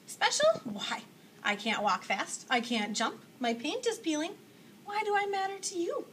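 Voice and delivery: can't believe it's true; disbelieving. Acted. Punchinello answers his maker with loud, high disbelief.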